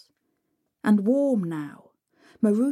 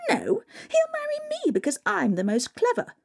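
much higher, airy dreamy voice; giddy stepsister; 13 semitones higher than usual. Acted. A stepsister dreams of husbands, high and giddy. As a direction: squeal it like a silly girl.

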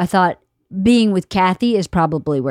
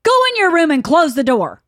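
higher, louder bossy orders; mock stern dad; 7 semitones higher, 4 dB louder than usual. Imitating Bert ordering the daughter, stern. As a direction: bark it like an angry dad. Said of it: "Bert was like".

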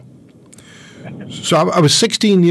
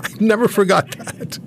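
usual speaking voice

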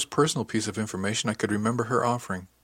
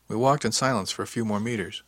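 ordinary delivery

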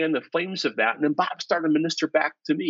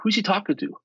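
puzzled; 7 semitones higher, faster than usual. Retold or imitated. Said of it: "I'm like".

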